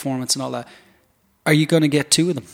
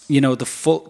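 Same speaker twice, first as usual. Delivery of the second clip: usual speaking voice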